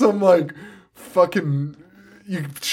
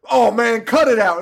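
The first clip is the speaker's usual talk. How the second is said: mock friend scolding; 8 semitones higher, 4 dB louder than usual. Friend's mocking quote in movie.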